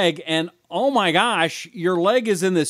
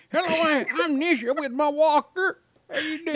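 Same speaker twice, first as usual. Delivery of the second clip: put-on high, soft old granny voice; old lady voice; 10 semitones higher, 3 dB quieter than usual. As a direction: talk like a frail little grandma. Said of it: "an old lady voice today".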